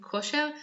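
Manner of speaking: kosher with a pure O and clear "-er", Israeli way; Israeli accent. Shown as a demonstration. Teacher explaining the word for fitness, kosher in an Israeli accent. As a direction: say kosher as Hebrew "ko-SHER". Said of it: "the Israeli accent".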